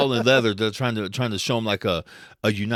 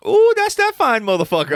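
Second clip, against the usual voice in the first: imitating thrilled mom; 8 dB louder than usual. Mom seeing the costume in the theater, loud admiring squeal. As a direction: gush loudly like a mom eyeing a hero. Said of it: "She goes".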